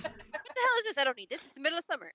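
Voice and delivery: lightly acted high-pitched child's complaint; mock ungrateful child. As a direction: play a put-out kid protesting to mum. Acting out ungrateful child receiving blanket.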